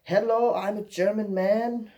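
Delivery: high, drawn-out German guy impression; loud, exaggerated greeting from a German man; Lucas. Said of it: "run into this guy named Lucas. He's like".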